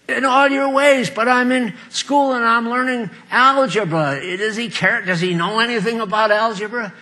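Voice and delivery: a hint of a worried student at his algebra; questioning schoolkid. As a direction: play the doubtful pupil, lightly voiced. Voicing a schoolkid's doubtful questions.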